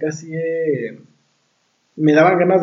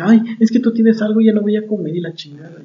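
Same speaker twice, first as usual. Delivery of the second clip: higher, whining mock girlfriend voice; mock whiny girlfriend; 7 semitones higher than usual. Imitating his date refusing to eat, higher whine.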